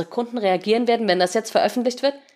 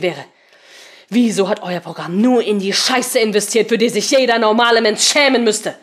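spitting words; 4 semitones higher, 7 dB louder than usual. Acted. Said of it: "Seemann spuckte die Worte aus".

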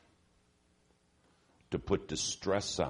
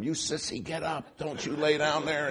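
higher pitched mock yell at a downed boxer; retold men yelling; 9 semitones higher than usual. Ringside men taunting a fallen boxer, mock yell. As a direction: jeer him to get up, hammed up. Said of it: "all the other men are screaming at him".

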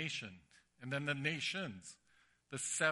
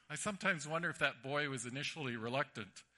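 ordinary talk

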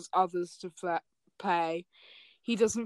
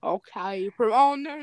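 ordinary delivery

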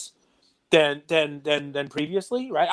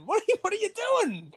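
outraged disbelief; 4 dB quieter, faster than usual. Real, not acted. Outraged at sticker on card.

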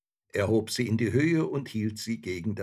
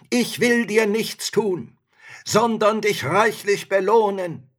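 pleading spirit; 11 semitones higher, 10 dB louder than usual. A narrator's reading. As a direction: coax loudly to be set free. Trapped spirit pleads for release.

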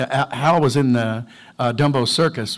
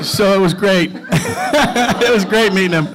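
laughing; 7 semitones higher, 5 dB louder than usual. Real, not acted. Laughing after the punchline.

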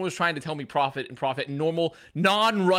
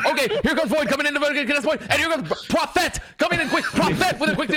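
high, fast mock play-by-play; mock caster rushing through a fight; 10 semitones higher than usual. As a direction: call it like a hyped esports caster.

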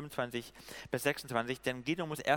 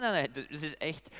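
ordinary talk, nothing marked

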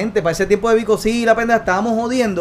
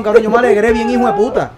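ordinary delivery

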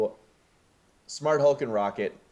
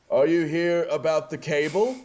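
higher, louder, bleary slacker voice; drunk Thor voice; 8 semitones higher, 5 dB louder than usual. Retold or imitated. Podcaster voicing a drunk Thor calling from off camera, higher and louder. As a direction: call out like a drunk couch potato from the next room. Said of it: "you hear this voice off camera say".

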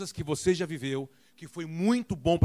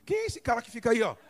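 imitating people; 9 semitones higher than usual. Preacher imitating people online, higher amazed voice. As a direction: play the curious viewers. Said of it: "o pessoal todo assim".

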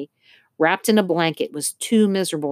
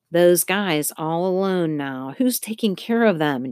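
plain reading voice

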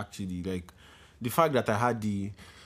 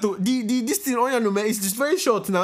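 excited protest; 9 semitones higher, 3 dB louder than usual. Real, not acted. Host protests short speech.

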